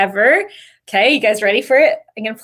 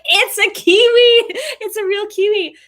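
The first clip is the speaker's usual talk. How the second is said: excited; 11 semitones higher, 4 dB louder than usual. Retold or imitated. Spotting a real kiwi in the forest, excited squeal. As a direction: squeal it out like you can't believe it.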